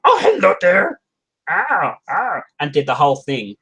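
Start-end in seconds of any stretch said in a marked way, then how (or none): 0.1-2.4 rehearsed character voice. Retold or imitated. Rehearsing script lines alone at night, high silly character voice. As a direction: squeal out the hello in a high character voice. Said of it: "went through the thing going".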